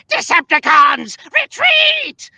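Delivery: high, loud screeching battle command; drawn-out high-pitched villain yell; Chris Latta's Starscream. Acted. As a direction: shriek it like a cartoon villain ordering troops. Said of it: "was originally voiced by Chris Latta".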